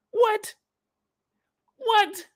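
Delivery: high squeaked disbelief; a kid who can't believe it; shocked what. Retold or imitated.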